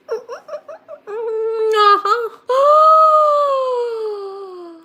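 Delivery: long loud excited gasp; delighted gasp. Real, not acted. Puts on glasses, gasps.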